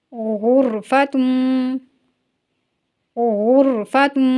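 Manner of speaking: شرب and غرفتم slow, each ra thickened; Urdu: آہستہ تکرار، را پُر; slow heavy ra. Shown as a demonstration. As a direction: practice repetition, drawing out every ra. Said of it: "دہرائیے اور practice کیجیے".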